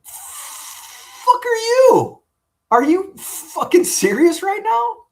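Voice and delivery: high, loud, drawn-out disbelieving outburst; retold outrage. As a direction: stretch it out, appalled and furious. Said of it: "And I'm like".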